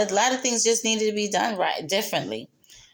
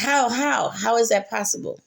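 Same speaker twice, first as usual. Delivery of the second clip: usual speaking voice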